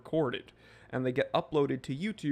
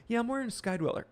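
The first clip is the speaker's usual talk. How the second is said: high mock-boastful voice; mock bragging; 9 semitones higher, faster than usual. Streamer mimicking a chatter flaunting a watch, high mocking brag. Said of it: "You're like".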